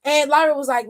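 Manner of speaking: ordinary talk, nothing marked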